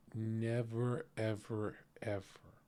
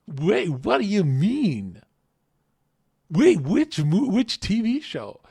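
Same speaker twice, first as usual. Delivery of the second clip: much higher and louder, stunned and eager; a guy who can't believe the compliment; mock eager Chad; 9 semitones higher, 14 dB louder, slower than usual. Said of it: "The Chad goes".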